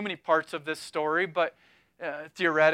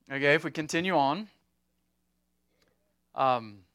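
normal talking voice

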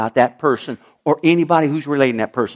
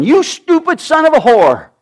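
Saul's curse, raised and loud; furious curse; 9 semitones higher, 5 dB louder than usual. Acted. Saul swearing at his son Jonathan in rage. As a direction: spit it at him in rage. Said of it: "he swore at him".

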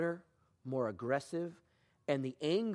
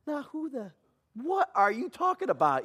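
high bewildered objection; puzzled, defensive; baffled; 9 semitones higher than usual. Retold or imitated. Young man to the praying pastor, high baffled objection. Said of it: "I'm like".

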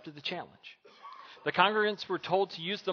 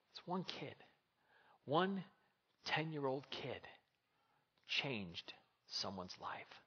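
soft, slow, weighty; hushed emphasis; 9 dB quieter, slower than usual. Acted. Preacher letting the point land, soft and slow. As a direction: drop to a near hush and slow right down.

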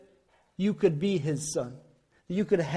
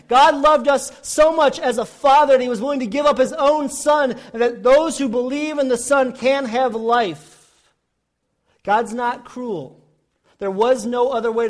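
loud, high, impassioned preaching; forceful preaching; 5 semitones higher, 8 dB louder than usual. Real, not acted. Preacher telling the congregation of God's love, preaching forcefully. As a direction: drive the point home at full voice.

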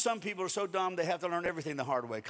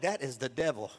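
amused, lower mock dread; a believer joking about a burger being the devil; laughing mock horror; 8 semitones lower than usual. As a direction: laugh while calling it evil.

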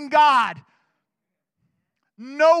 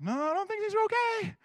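worried disciple; 7 semitones higher, 7 dB quieter than usual. Retold or imitated. Disciples panicking in the storm.

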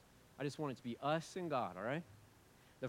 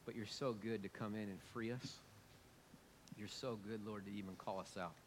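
softer, tender prayer to God; 4 semitones lower, 8 dB quieter than usual. Acted. Pastor praying softly over congregation, tender prayer. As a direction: pray quietly and tenderly. Prays after sermon.

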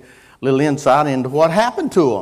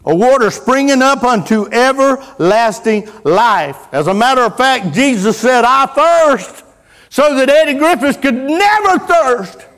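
full-force preaching; 11 semitones higher, 7 dB louder than usual. Real, not acted. Preacher declaring he will never thirst, full-force preaching. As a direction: build to a high, loud preacher's peak.

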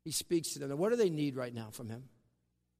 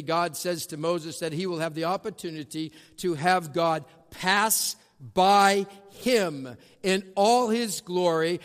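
normal talking voice

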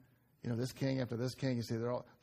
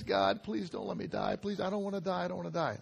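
begging king; 5 semitones higher, faster than usual. Retold or imitated. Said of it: "he pleads, and he begs and begs".

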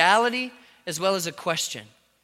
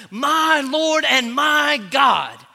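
louder, higher, very slow cry; exploding proclamation; 6 semitones higher, 7 dB louder, much slower than usual. Acted. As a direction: let it burst out of him. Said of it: "came exploding out of his mouth".

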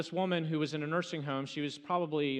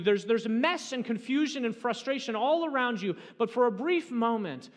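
raised, louder pulpit voice; preaching at full force; 8 semitones higher, 8 dB louder than usual. Acted. Pastor urging the congregation, preaching at full force. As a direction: press the point home to the congregation.

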